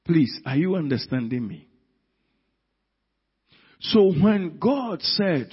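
usual speaking voice

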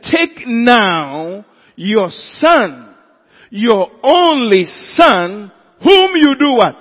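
"Take now your son" drawn out slowly, high and loud; loud, stretched preacher's emphasis on "son"; heavy emphasis. Acted. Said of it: "Why the emphasis?".

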